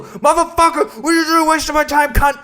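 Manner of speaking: high-pitched exasperated rant; furious outburst. Retold or imitated. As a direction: explode at the driver who cancelled, voice shooting way up. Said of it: "Yeah, I was pretty mad".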